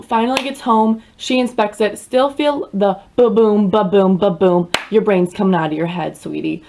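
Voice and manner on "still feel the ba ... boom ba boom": slow, thumping ba-boom; mock heartbeat. Describing the throbbing head, slow heartbeat thumps. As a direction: pulse it out slowly like a heartbeat.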